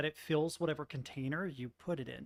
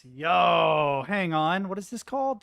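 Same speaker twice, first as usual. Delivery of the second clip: loud, rising hang on; excited; 2 semitones higher, 11 dB louder than usual. Real, not acted. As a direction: call out hang on, hooked. Reacting excitedly to game.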